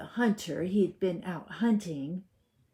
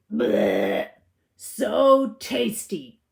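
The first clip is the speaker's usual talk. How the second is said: 1 smug wolf; 2 semitones higher, 7 dB louder, much slower than usual. A narrator's reading. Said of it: "he said, patting his belly".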